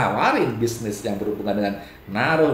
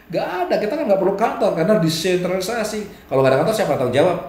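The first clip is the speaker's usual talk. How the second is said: higher, lively mock dialogue; acted exchange with a Ponzi seller; 7 semitones higher, faster than usual. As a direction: play both the doubter and the smooth seller. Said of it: "Kalau tanya".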